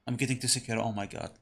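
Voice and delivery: ordinary talk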